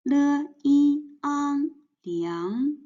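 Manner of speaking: "Le, yi, ang" held level and high, then "liang" rising; second tone. Shown as a demonstration. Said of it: "this is the second tone".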